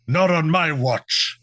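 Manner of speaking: hammed-up Scottish brogue sliding into pirate; Scottish, turning pirate. Scottish ghost defies his rival, mock Scottish pirate accent. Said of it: "the more Scottish one goes".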